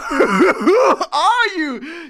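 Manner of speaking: laughing out the words slowly; slow laughing question. Real, not acted. Asking who the announcement was for, laughing slowly. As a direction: let the laugh drag the question out.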